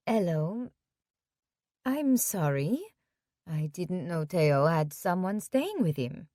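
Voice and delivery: put-on a light French accent, politely. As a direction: speak gently with a French accent. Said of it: "she has a French accent".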